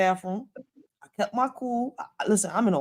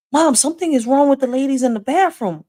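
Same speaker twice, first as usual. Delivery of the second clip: put-on child's voice; 7 semitones higher, 5 dB louder than usual. Son reporting to mother.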